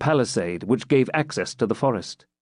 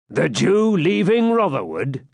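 slow, higher, scornful disbelief; sneering swineherd query; supercilious; 7 semitones higher, 3 dB louder, slower than usual. A narrator's reading. Swineherd scoffing at the pilgrim, superior. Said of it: "looking superciliously at him".

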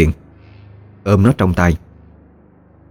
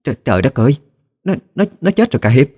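high, stuttering alarm; horrified exclamation; 8 semitones higher, faster than usual. A narrator's reading. Old one sees the boy is dead, horrified. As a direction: cry out in shock, pitch jumping, without shouting. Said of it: "Bỗng cụ thất kinh kêu lên".

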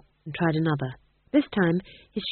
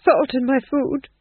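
high, louder nervous remark; 5 semitones higher, 5 dB louder, slower than usual. A narrator's reading. Duke adds his own charge against Nanny, nervously. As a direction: blurt it uneasily, pitched up. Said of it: "said the Duke nervously".

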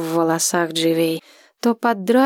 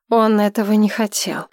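ordinary delivery

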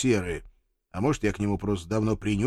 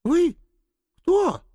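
put-on much higher, startled girl's voice; young woman voice; 5 dB louder than usual. Red-haired girl asks the stranger, higher girl voice. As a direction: ask it like a wary young girl.

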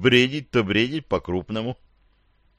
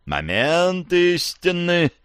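very slow, higher solemn pronouncement; sagely; 7 semitones higher, much slower than usual. A narrator's reading. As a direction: announce it with mock gravity. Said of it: "глубокомысленно заметил Раздражаев".

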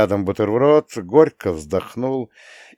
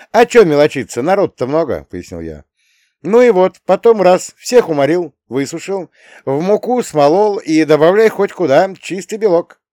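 high, loud youthful voice; breezy boy schemer; 8 semitones higher, 7 dB louder than usual. A narrator's reading. Boy explaining cricket production to grandpa, high breezy confidence. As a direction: rattle off the scheme pitched well up.

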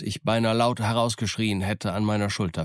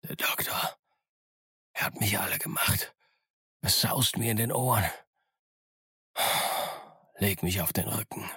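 weak unvoiced whisper, much softer; 5 dB quieter than usual. A narrator's reading. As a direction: whisper it faintly, almost gone. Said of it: "Dann flüsterte er".

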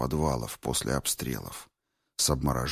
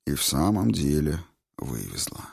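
usual speaking voice

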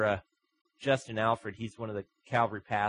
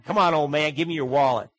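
mocking taunt; 3 semitones higher, 10 dB louder than usual. Mugger taunts the old man.

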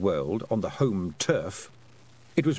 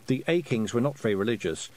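ordinary delivery, nothing marked

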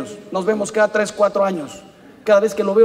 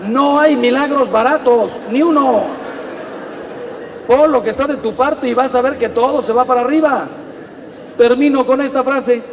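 fervent preaching; 4 semitones higher, 4 dB louder than usual. Acted. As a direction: drive the point home to the congregation. Preaching at full force.

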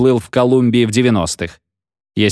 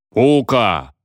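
dark lord. A narrator's reading. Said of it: "ухнул Тонкс зловещим басом".

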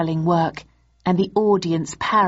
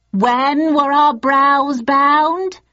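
high, loud, drawn-out question; audience yell; 8 semitones higher, 5 dB louder, much slower than usual. A narrator's reading. Audience cueing Richard, yelling slowly. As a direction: yell it slowly like a crowd cue. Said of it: "yelled the audience".

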